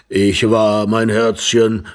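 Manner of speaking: put-on elderly voice, drawn out; slow old man addressing his darling; old husband. A narrator's reading. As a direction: play the old husband, slowly. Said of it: "mit schwacher Stimme".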